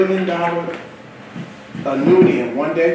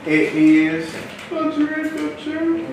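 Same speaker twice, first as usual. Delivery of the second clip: usual speaking voice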